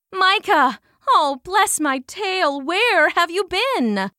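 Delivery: fretful mother welcoming him home; mother mouse. A narrator's reading. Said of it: "she said".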